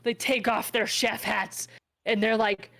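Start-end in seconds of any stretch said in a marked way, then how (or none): none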